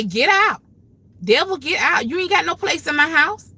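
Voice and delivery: loud, high rebuke; rebuking the devil. Retold or imitated. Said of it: "and I say".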